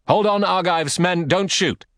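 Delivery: high commanding shout across the field. Acted. Agamemnon stops his archers with a high shout. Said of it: "a shout from Agamemnon".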